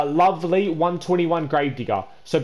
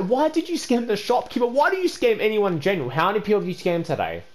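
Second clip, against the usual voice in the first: normal talking voice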